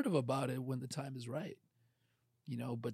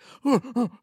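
mock gasp; 8 semitones higher, 10 dB louder than usual. Mocks huffy wife.

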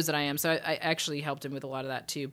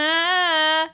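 mock ah; 13 semitones higher, 13 dB louder than usual. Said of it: "just kinda go".

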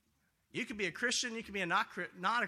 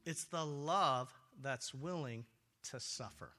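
"It's the love that's willing to suffer" drawn out slowly, dropping lower and much softer on "to suffer"; 5 semitones lower, 4 dB quieter than usual. Acted. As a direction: let "to suffer" sink down to almost nothing. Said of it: "It's a love that's willing to suffer".

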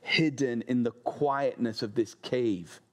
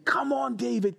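high, coaxing "Come on, David" from his men; lightly voiced men egging David on; urging men; 11 semitones higher than usual. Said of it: "the voice of his men saying to him".

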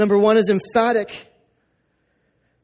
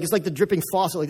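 ordinary talk

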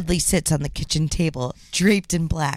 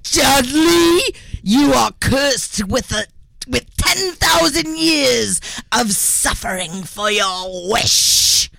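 booming curse; 7 semitones higher than usual. Acted. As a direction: cast the curse with a boom. Spirit pronounces curse.

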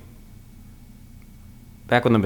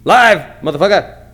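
triumphant shout; 7 semitones higher, 6 dB louder than usual. Real, not acted. Finally going live on Facebook.

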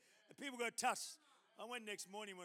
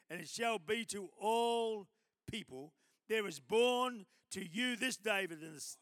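proclaimed Scripture reading; 11 dB louder than usual. Acted. Preacher reading the angel's Christmas news to church, proclaiming. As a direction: proclaim each phrase with big emphasis.

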